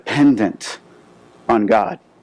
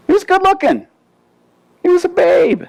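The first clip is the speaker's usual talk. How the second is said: high, hammed-up gushing aside; playful swoon over a handsome man; mock swooning. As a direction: gush like a smitten fan, way up high. Preacher swoons over Joseph's looks.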